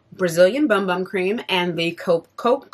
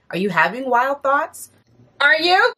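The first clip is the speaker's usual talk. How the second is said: a light Southern US twang, teasing; playful Southern accent; 7 semitones higher, 3 dB louder than usual. Pretend. Quoting a [name] song at viewers, in a light Southern accent. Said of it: "I don't know where this accent came from".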